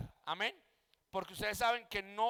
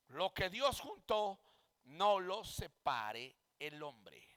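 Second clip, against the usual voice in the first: slow, low, weighty "Lo que Dios juntó, no lo separe el hombre"; firm affirmation; 5 semitones lower, slower than usual. Shown as a demonstration. Pastor restates the verse to his class as a firm statement. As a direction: lay down each word as a settled fact. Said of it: "Una afirmación".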